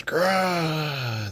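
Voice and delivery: high strangled monster cry. Retold or imitated. Monster hit by the sword, high pained cry. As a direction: yelp like a struck beast. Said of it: "then I guess the monster goes".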